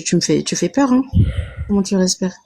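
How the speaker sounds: ordinary talk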